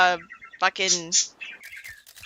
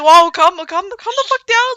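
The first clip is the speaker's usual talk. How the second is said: loud, high, alarmed outburst; startled; 10 semitones higher, 7 dB louder, slower than usual. Retold or imitated. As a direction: voice a startled stranger, pitched up. Startled stranger facing panicking runaway.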